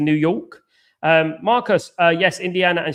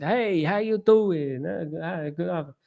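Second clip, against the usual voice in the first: higher, drawn-out stranger's greeting; friendly Detroit stranger; 4 semitones higher, 4 dB quieter, slower than usual. Retold or imitated. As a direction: imitate a local greeting a passer-by, slow and high. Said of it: "people come up to me ... in Detroit and said".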